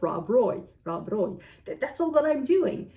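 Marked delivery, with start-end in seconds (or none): 0.0-1.4 Rob Roy with both R's rolled. Shown as a demonstration. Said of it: "I'm just rolling the R".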